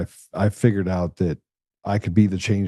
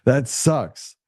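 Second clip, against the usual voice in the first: quoting the man in the wheelchair; 4 semitones higher than usual. Retold or imitated. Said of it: "I remember the guy saying it".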